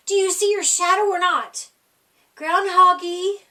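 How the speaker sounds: impatient friends calling out; impatient crowd. Acted. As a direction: press him for the answer. Crowd demanding an answer.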